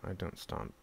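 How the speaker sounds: usual speaking voice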